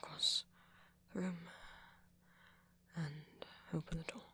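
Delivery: hushed, halting pep talk to oneself; nervous self-coaching. Acted. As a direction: talk yourself through it under your breath.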